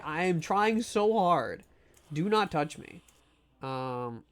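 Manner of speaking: ordinary talk, nothing marked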